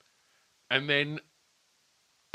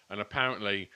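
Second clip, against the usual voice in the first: normal talking voice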